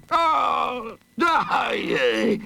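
high wailing monster cry; villain's anguished shout. A narrator's reading. The Hooded Fang blinded by sudden sunlight, shouting. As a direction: howl it, stretching the words. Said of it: "shouted the Hooded Fang".

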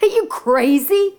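high, disbelieving outburst; can't believe what he hears; incredulous prince. Acted.

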